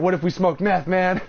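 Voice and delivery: teasing retold panic; mock dread. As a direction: ham up the stoned fear. Scary as fuck.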